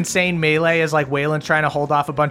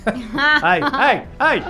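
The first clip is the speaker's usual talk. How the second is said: playful scolding; 10 semitones higher, much slower than usual. Acted. Scolding the cursing player.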